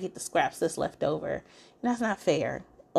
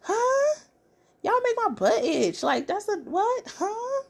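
over-the-top disbelief; 14 semitones higher than usual. Real, not acted. The host reacting to husbands shushing wives, very high mock outrage. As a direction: ham up the bafflement. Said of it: "I'm like".

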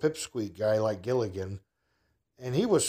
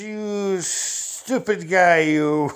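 mock scolding lady; 6 semitones higher, 4 dB louder, much slower than usual. Woman scolding clumsy narrator.

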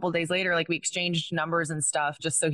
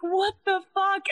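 shocked disbelief; 12 semitones higher than usual. Retold or imitated. Stalker calling from unknown numbers.

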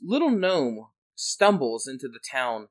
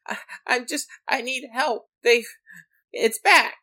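desperate gnome; 13 semitones higher, 7 dB louder than usual. Acted. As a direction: plead with the last of his strength. Gnome begging for help.

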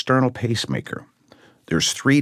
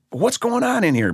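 retold doctors. Doctors storm in, voiced.

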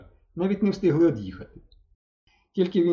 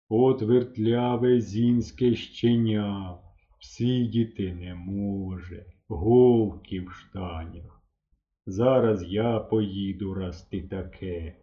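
very slow, lower, lips stretched wide; drawling words; 6 semitones lower, much slower than usual. A narrator's reading. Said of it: "широко розтягуючи губи, і разом з ними слова". (мисливець лає хлопця, тягнучи слова)

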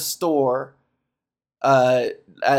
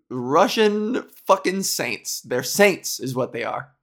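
ordinary talk

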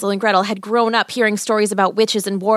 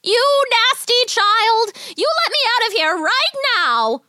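very high, drawn-out furious screech; shrieking witch; slower than usual. Acted. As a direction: screech to be let out. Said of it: "the witch cried".

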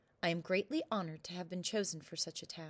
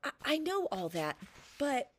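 frightened stammer; 6 semitones higher than usual. Acted.

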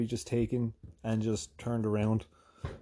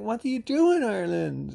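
eager admirer; 10 semitones higher, 4 dB louder than usual. Retold or imitated. Mimics the admirer's eager question.

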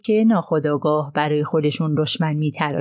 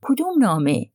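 sharp question; 3 semitones higher than usual. A narrator's reading. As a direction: pounce on "کدوم", then drop. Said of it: "پوآرو با تندی گفت".

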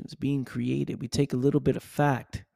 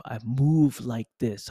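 ordinary talk

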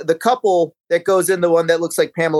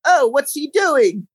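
high, drawn-out British puzzlement; slow, pitched-up British mock wonder; mock British accent; 8 semitones higher, slower than usual. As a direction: imitate a clueless British onlooker, slowly. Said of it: "the British accents are just so".

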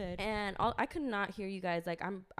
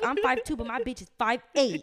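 loud, high boast; mock squaring up; 4 semitones higher, 9 dB louder than usual. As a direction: throw out a playful challenge, loudly. Retold squaring up to rival swimmers.

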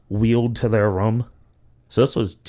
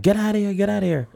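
higher, urgent get outta here; shooing him off; 10 semitones higher, faster than usual. Retold or imitated. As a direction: shoo them away in a high voice. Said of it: "they're like".

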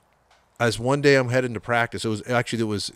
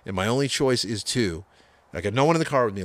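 usual speaking voice

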